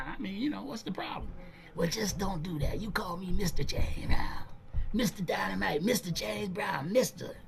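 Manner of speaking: hushed, high and stubborn; insistent whisper. Retold or imitated. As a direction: whisper it back, not giving an inch. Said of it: "Brown insists in whisper".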